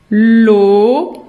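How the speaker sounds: "loto" loud, split as looo, to; syllable by syllable. Shown as a demonstration. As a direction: stretch the first syllable of "loto", then add the short second. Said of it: "ça s'écrit".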